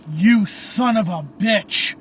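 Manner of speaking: loud, slow furious accusation; angry outburst. A narrator's reading. Caleb confronts the Phantom, loud and angry. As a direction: hurl it at your enemy.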